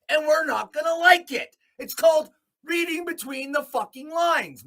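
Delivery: loud, high, furious ranting; angry rant. Real, not acted. Angry vlogger shouting at the government and his viewers. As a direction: shout it at the whole system.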